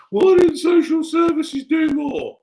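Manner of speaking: high, loud and drawn-out complaint; slow, high mock shout; mock public outcry. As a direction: shout it as an indignant accuser. Voicing public outcry at social services.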